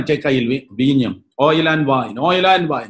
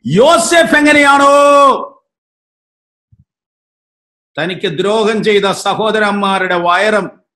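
loud, high-pitched revival preacher; fervent shouted prophecy; full-force preaching; 6 semitones higher, 6 dB louder than usual. Real, not acted. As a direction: proclaim it to the whole congregation, voice raised.

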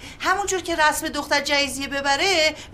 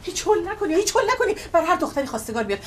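ordinary delivery

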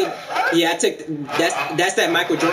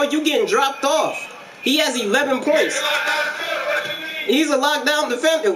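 high, hyped sports-announcer call with a country twang; country NFL commentator hyping a defender; 6 semitones higher than usual. Acted. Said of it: "you talk like a N F L commentator, except with some countryness".

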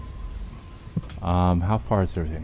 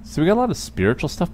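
normal talking voice

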